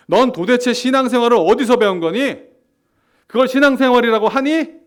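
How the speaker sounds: loud, high, scornful accusation; condemning rebuke. Acted. Pastor voicing an arrogant believer condemning someone's faith, loud and scornful. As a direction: sneer and shout the blame. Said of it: "한번 실감나게 한번 해보겠습니다".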